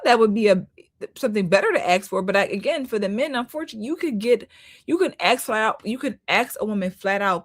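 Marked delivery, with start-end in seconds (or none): none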